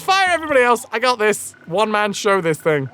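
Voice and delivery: high-pitched mock bragging, rattled off fast; hammed-up know-it-all understudy; self-important twat. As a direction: play the cocky actor taking over everything. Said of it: "get into the role of a twat".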